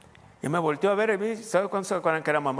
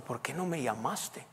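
gentle reproach; 7 dB quieter, slower than usual. Retold or imitated. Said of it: "me dijo el pastor".

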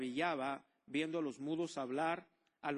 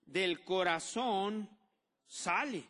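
slow emphasis; 3 semitones higher, 7 dB louder, much slower than usual. Acted.

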